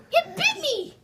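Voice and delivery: high startled exclamation; amazed it hurt him. Acted. Hedgehog surprised by the cold snowball, startled.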